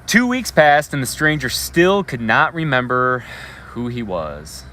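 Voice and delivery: storyteller voice projecting to a classroom; story read-aloud. Acted. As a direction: read the picture book to the kids with energy. Teacher reads story aloud.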